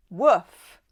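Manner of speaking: loud, punchy "Woof" falling off; woof exclamation. Real, not acted. Tarot reader reacting to a hot card, loud woof. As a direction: blurt out an impressed woof.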